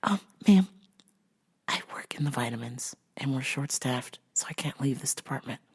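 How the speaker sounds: soft calm whisper to a customer; deliberately soft store-clerk whisper. Retold or imitated. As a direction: keep it hushed and steady. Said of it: "And I was like".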